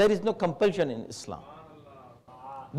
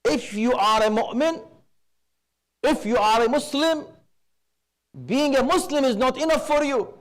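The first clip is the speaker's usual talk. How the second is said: usual speaking voice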